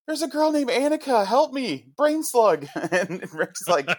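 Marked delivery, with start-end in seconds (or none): 0.1-2.7 very high, loud, panicked squeal; high-pitched, hammed-up plea for help; Morty impression. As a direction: blurt it out as a frantic cartoon character. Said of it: "And Morty just goes".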